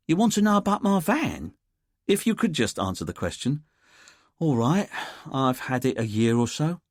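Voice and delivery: puzzled suspect, shrugging it off; lightly voiced Londoner. A narrator's reading. Andy voiced in the audiobook.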